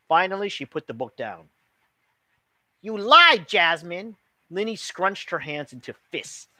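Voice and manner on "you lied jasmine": loud, hurt outburst; angry accusation. A narrator's reading. Hurt friend accusing Jasmine of lying, loud and angry. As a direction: hurl the accusation.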